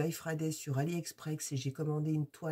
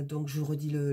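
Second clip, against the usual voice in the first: ordinary talk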